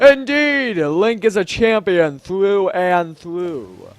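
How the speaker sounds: slow, proclaiming monarch; grand Zora king, grave. Acted. King warning that the domain is doomed, grand and grave. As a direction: stretch out each phrase with regal weight.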